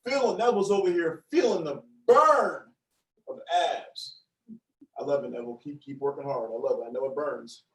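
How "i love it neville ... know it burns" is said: low, soft mock-sultry praise; put-on sexy low register; deep sexy voice. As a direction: encourage in a playfully smooth, deep voice. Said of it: "my voice is gonna go like this".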